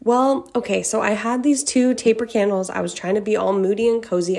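normal talking voice

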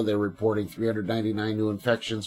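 ordinary delivery

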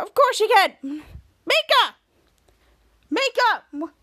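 high, loud call of a name. Retold or imitated. Ray calls Mika through the door, loud and high.

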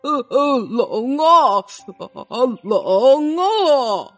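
slow, drawn-out shivering moan. A narrator's reading. Unhappy elephant answers Emma about the cold, shivering. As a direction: stammer and groan with the cold.

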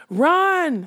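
sharp high shout of run; urgent cry. Acted. Smendrick urging the unicorn to run, shouted. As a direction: yell at them to flee now.

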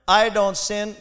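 mock-proud prayer, building to a loud, slow cheat; hammed-up self-righteous boast to God; smug Pharisee prayer. Preacher mimics the Pharisee praying smugly, mock-proud. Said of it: "prayed this prayer".

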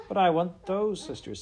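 put-on high, pleading child voice; pleading little girl. A narrator's reading. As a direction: beg like a small girl. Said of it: "said Sally".

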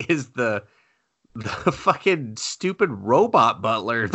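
usual speaking voice

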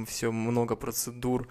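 ordinary talk

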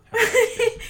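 squealing laugh through a cashier line; giggling fake cashier. Mock cashier greeting, laughing.

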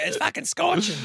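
high-pitched mock complaint; squealed, exaggerated whinge; mock overreaction. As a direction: imitate someone melting in mild heat. Mocking people overreacting to mild heat.

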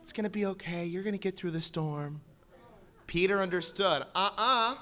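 normal talking voice

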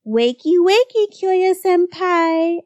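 high, slow singsong wake-up call; drawn-out, high teasing lilt; laughing tease. A narrator's reading. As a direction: chirp it to needle a sleeper. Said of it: "laughed Kaoru".

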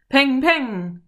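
loud, high bang-bang; punchy shouted shot noises; imitated gunshots. A narrator's reading. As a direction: fire off spoken gunshots. Narrator voices gunshots.

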